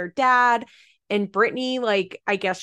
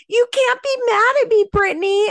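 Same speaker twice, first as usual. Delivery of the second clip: shrill-free but much higher, louder whiny mock of Roxanne; 13 semitones higher, 6 dB louder than usual. Podcaster mocking a TV mom's whiny plea, high and loud. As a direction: squeak it out as a put-upon mother mocked. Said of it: "And then Roxanne is like".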